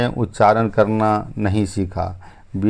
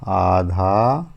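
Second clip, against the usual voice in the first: slow stressed word; 3 semitones lower, much slower than usual. Shown as a demonstration. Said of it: "क्या होता है?".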